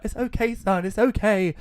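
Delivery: high, loud soothing mother voice; mock mum reassurance. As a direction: play the mum calming her son in mock panic. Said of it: "And she's like".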